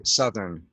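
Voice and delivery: southern with a short uh vowel, suh-thern; suh-thern, short plain vowel; teacher's model southern. Shown as a demonstration. Teacher corrects the student, southern said as suh-thern. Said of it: "we pronounce southern".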